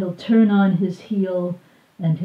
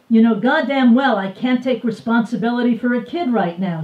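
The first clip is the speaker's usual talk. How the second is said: louder, higher, fast mock shout; a hint of a shouting man; narrated shout; 5 semitones higher, 5 dB louder, faster than usual. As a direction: fire it back at her, raised and quick. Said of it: "he shouts".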